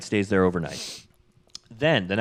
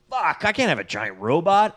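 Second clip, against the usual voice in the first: exasperated alarm; 7 semitones higher than usual. Retold or imitated. Dean refusing in alarm.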